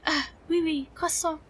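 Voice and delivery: badly faked French accent slipping into German; French accent turning German. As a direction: let the mock French accent drift German. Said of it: "See what I said about it becoming German".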